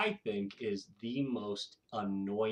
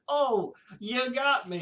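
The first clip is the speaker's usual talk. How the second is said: high, slow and louder, playful groan; mock good sport; 12 semitones higher, 6 dB louder, slower than usual. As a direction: play the fooled victim, hammed up.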